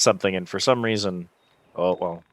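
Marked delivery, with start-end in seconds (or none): none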